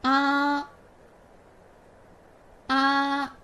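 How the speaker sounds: open-mouthed Ah; pinyin a. Shown as a demonstration. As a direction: drop the jaw wide and hold a clear Ah. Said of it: "Read after me".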